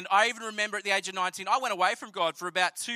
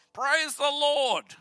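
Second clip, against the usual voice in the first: very slow, stretched "Praise the Lord"; a mock-pious drawn out blessing; 4 semitones higher, much slower than usual. Telling his pa the prayer worked, drawn out praise.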